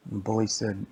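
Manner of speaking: ordinary delivery, nothing marked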